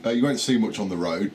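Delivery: usual speaking voice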